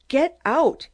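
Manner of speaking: loud, high scream of get out, hitting GET hard. A narrator's reading. Zalnitch screaming at his visitor to get out, loud and high. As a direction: shriek it at the intruder. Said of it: "he screamed".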